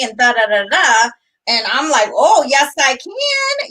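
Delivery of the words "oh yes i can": high, sassy mock defiance; defiant retort. Wife retelling her defiant reply to her husband. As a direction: snap it back with attitude.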